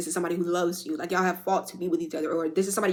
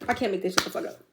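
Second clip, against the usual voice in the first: quiet, teary repeat; 4 dB quieter, faster than usual. Real, not acted. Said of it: "I'm a crybaby".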